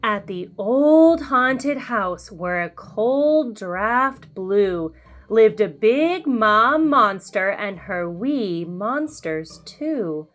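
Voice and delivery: ordinary delivery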